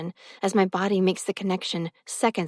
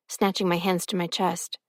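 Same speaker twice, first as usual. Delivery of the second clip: usual speaking voice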